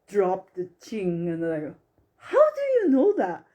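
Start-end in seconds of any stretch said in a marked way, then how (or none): 2.2-3.5 high, loud, quick astonished question; retold shock. Client asks the psychic how she knows, shocked disbelief. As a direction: act out a stunned client, voice leaping up.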